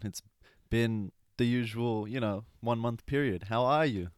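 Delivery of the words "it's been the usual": ordinary talk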